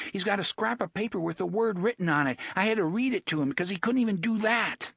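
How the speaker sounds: high, fast telling-on; the boy exposes Gasher to the boss; accusing boy. Acted. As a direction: rattle it off, pointing the finger.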